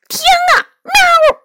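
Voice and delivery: very high startled cat yelp; terrified exclamation ending in a high meow; frightened cry. A narrator's reading. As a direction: cry out in fright, pitch shooting up. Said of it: "梨花叫道".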